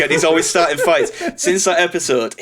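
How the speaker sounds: ordinary delivery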